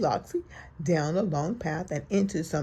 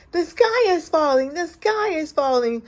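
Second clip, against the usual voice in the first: high, loud panicky cry; little chick shouting the sky is falling; crying Chicken Little; 8 semitones higher, 6 dB louder than usual. A narrator's reading. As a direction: squawk the alarm like a frightened chick. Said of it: "cried Chicken Little".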